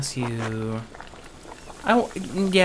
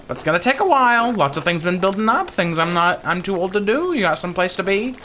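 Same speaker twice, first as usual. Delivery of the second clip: put-on higher-pitched old villager voice; old Ottilia, grumbling; 7 semitones higher, 6 dB louder, faster than usual. Old Ottilia listing chores to her helper, grumbling. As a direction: grumble it up high as an old woman.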